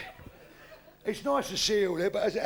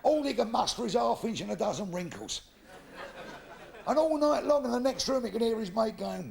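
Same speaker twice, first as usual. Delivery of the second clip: ordinary talk, nothing marked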